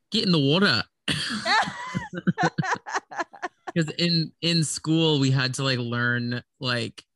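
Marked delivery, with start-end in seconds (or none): 0.1-0.8 a quick Australian phrase, played for laughs; Australian accent. Shown as a demonstration. To friends, showing her one Australian phrase: a light Australian accent. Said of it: "I can only say one phrase". 1.1-3.7 high giggle after the accent; high laugh. Real, not acted. Laughing at her own accent attempt: high giggle.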